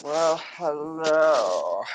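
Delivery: Snape-like drawl. Acted. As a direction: drawl it like a sinister professor. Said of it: "You sound like a really terrible Professor Snape".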